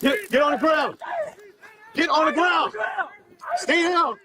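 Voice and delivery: high, forceful police yell; shouted command. Real, not acted. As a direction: shout him down to the ground. Officer commands during arrest.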